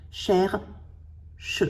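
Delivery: "Cherche" as "cher", long pause, "che"; two-beat sounding out of "Cherche"; split into syllables. Shown as a demonstration. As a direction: keep the R in the first beat of "Cherche", then "che" alone. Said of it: "le R dans la syllabe".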